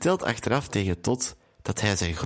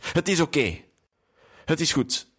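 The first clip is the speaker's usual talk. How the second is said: higher-pitched, understated tearful upset; mildly tearful; 5 semitones higher than usual. A narrator's reading. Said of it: "zegt Sonja met tranen in haar ogen".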